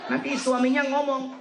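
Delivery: ordinary talk, nothing marked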